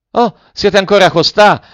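high, loud call to friends far off; calling from afar. A narrator's reading. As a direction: cry out brightly as if across a garden. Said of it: "gridò Velleda da lontano".

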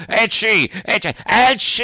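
high, loud sneezing bursts; three sneezes. A narrator's reading. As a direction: sneeze three times in a row. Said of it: "fece altri tre starnuti".

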